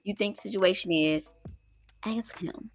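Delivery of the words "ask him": soft unvoiced whisper. Real, not acted. Mom whispering advice to viewers.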